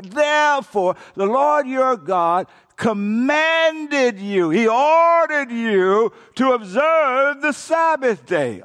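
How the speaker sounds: high, loud and slow, every word hammered; booming preacher shout; shouted therefore. Shown as a demonstration. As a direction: proclaim it like a command from the pulpit. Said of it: "can you shout".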